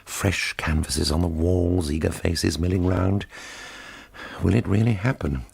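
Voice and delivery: ordinary delivery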